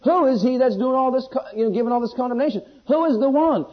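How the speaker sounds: high, loud sermon delivery; forceful preaching. Acted. Preacher challenging listeners over who condemns them, forceful. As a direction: press the rhetorical questions on the congregation.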